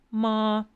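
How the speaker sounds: MA high, loud and level; first tone. Shown as a demonstration. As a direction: hold Ma on a high unchanging pitch. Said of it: "That's first tone".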